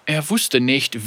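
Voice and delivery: usual speaking voice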